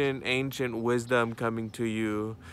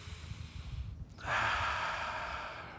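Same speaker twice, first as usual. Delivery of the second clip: very long, airy breath out; meditative exhale; 10 dB quieter than usual. Real, not acted. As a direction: let the breath go slowly and fully.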